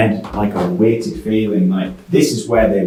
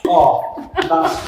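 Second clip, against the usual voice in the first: jumpy; 7 semitones higher than usual. Real, not acted. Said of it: "We both did".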